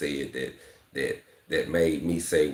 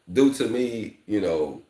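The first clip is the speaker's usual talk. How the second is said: usual speaking voice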